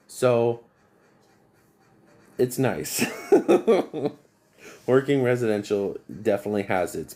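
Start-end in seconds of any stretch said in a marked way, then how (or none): 3.1-4.1 a short, high burst of laughter. Real, not acted. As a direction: laugh in amused disbelief. Laughs at customer's generosity.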